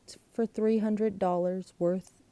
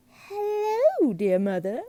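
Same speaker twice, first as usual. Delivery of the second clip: high, loud, syrupy hello; sweet greeting; 10 semitones higher, 6 dB louder, slower than usual. A narrator's reading. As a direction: gush it to your dear mother. Glunk greets mother on phone.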